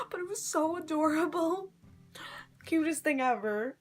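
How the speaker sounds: high, giggly gushing; laughing gush. Real, not acted. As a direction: laugh through how cute it was. Gushing about a toddler in costume.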